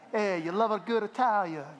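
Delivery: put-on half-baked stab at an Italian accent; bad Italian accent. Pastor teasing about good Italians, bad Italian accent. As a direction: try a hammy Italian accent. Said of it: "I don't know why I tried the accent".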